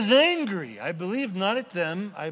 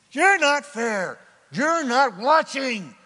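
higher, louder, slow accusation; accusing God; 6 semitones higher, 6 dB louder, slower than usual. Acted. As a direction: throw it at God, hurt and angry. Voicing humans accusing God.

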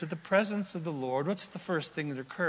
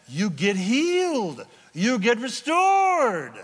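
rising from strong to loud, slowing; preacher swelling to a slow, loud high; building preaching shout; 5 semitones higher, 5 dB louder, slower than usual. Real, not acted. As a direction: build it up and hammer it out slowly.

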